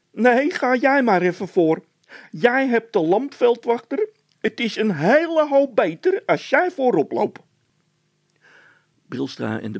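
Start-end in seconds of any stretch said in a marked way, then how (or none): 0.1-7.4 high, loud panicky voice; frightened Bol. A narrator's reading. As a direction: panic about the noise. (Bol in paniek, hoog en luid)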